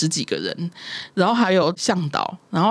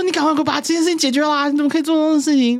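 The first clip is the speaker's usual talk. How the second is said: high, fast scolding of her mother; angry scolding; 9 semitones higher, faster than usual. Retold or imitated. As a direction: snap at mom to fix this now.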